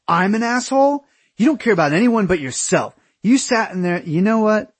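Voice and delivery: high-pitched, indignant rant; outraged comeback. A narrator's reading. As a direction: fire back in offended disbelief. Aaron's outraged comeback in quarrel.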